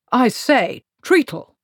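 loud high hail across the hall; calling out. Acted. Voice from above hailing Treatle, loud call. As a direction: call him over the storm.

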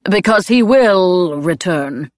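"will" drawn out and loud; slow, insistent stress on "will"; emphatic assurance. A narrator's reading. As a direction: lean hard and long on "will". Claude adds a pointed assurance.

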